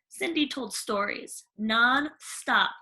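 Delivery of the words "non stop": ordinary delivery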